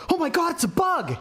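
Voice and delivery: high, laughing freak-out; panicked shriek. Retold or imitated. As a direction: shriek like he saw a bug. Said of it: "he goes".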